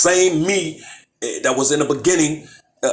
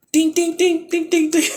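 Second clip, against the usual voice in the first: high, far-off ding-ding like a quiz bell; mock bell dings; 14 semitones higher than usual. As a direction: ring it out like a game-show bell, mocking. Mocking listeners as slow learners.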